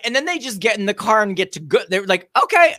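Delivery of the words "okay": mock breezy okay. Workers leaving casually.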